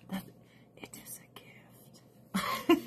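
hushed whisper; slow, breathy secret whisper. Real, not acted. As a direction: let it out as a whisper. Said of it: "whispered aside".

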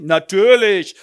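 loud drawn sneer; a drunk sneering at his wife; loud sarcasm. Acted.

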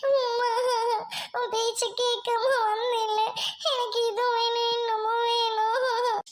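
slow, sobbing lament; tearful wailing. Acted. Girl lamenting that she ignored her mother, tearful wail. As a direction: cry it out with drawn-out words. Girl wails after the quarrel.

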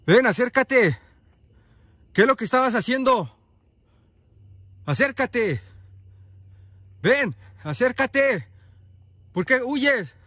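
grito agudo y fuerte hacia lo lejos; llama a gritos, retando a que se acerque; gritos de llamada. Real, not acted. Le grita a la criatura que huye. (gritando con fuerza a quien huye)

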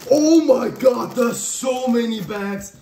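excited shout. Real, not acted. Shock at the huge bag haul.

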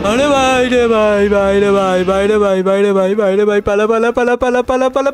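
panicked run, run; frantic shouting while fleeing; being chased. Real, not acted. Monster chasing, yelling run.

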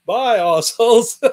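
loud, slow, gloating farewell; drawn-out mocking bye; smug goodbye. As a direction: wave them off with a smug sneer. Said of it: "I'll say".

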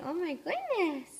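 ordinary delivery

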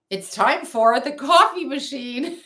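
playful announcement. Pretend. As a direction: announce it with mock fanfare, slowly. Playful announcement of coffee machine.

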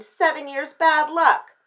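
high, loud, slow lament; storybook dismay; dismayed exclamation. A narrator's reading. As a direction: draw out the disaster. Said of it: "Goldie exclaimed".

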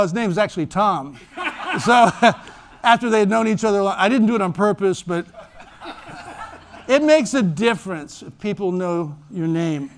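normal talking voice

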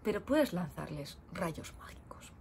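whispered aside. Real, not acted. Conspiratorial aside to viewers.

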